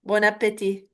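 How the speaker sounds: normal talking voice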